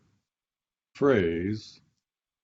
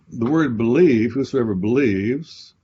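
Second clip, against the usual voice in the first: louder and slow, leaning on believe; preacher stressing the verse; emphatic; 2 semitones higher, 10 dB louder, slower than usual. Shown as a demonstration. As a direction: drive the key word home slowly. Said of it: "emphasizing the key word".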